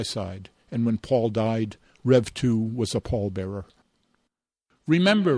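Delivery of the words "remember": high ringing call to the mourners; rally the crowd with one rousing word; exhorting. A narrator's reading. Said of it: "Rev two exhorted from the lectern".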